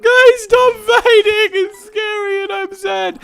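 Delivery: put-on high, slow childish whimper; mock a scared kid begging friends to stop; whiny scared plea. Gamer mocks a scared kid begging friends to stop, high and whiny. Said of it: "Not in such of a way of like".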